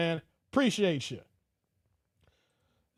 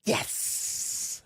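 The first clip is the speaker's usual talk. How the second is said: unvoiced, whispered "yes" with a long hiss; hissed mock celebration; 6 dB quieter than usual. Streamer imagining T Orlando getting divorce papers, whispered triumph. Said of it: "were you saying".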